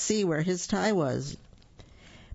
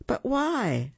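shocked; 9 semitones higher than usual. A narrator's reading. Grandmother shocked by news.

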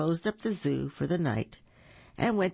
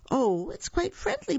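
high, lightly amused little monster; a touch of storybook giggliness; giggly delight; 14 semitones higher than usual. A narrator's reading. Boo finds the mouse friendly, giggly relief. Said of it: "Boo giggled".

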